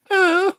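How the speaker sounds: high-pitched mock "uh"; loud, very high imitation grunt; imitated moan. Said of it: "sounded like somebody going".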